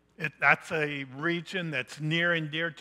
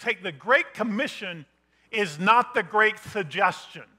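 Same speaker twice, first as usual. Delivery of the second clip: emphatic preaching; 2 semitones higher, 7 dB louder than usual. Acted. Pastor correcting the church's view of the commission, insistent and loud. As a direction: drive it home to the church.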